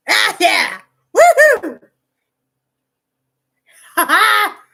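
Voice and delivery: loud, high whooping; excited cheer. Real, not acted. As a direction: whoop with real delight. Cheering at something during recording.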